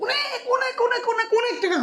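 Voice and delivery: very high sing-out, gradually coming down; exaggerated emphasis. Father-in-law exaggerating his spending on daughter, high-pitched. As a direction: stretch the repeats out in a high voice.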